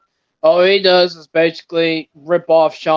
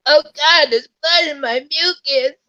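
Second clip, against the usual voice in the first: mock panicked whine; 10 semitones higher than usual. Cohost imitates the squashed wrestler after a sneeze, hammed-up high squeal. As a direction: squeak it like a wimp who just saw blood. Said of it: "He sneezes like".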